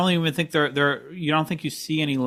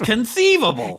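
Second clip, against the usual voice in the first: higher, louder Vizzini voice; Wallace Shawn impression; 10 semitones higher, 4 dB louder than usual. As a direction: exclaim it like Vizzini. Princess Bride quote.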